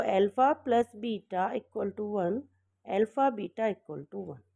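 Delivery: very slow, each word spaced for note-taking; teacher dictating slowly; slow dictation. Shown as a demonstration. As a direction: read it out slowly for the class to write. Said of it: "देखिए यहाँ पे लिख दो".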